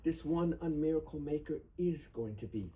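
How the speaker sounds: earnest, rising poetry recital; solemn reading. Acted. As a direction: read it as a tribute that builds. Reads protest poem aloud.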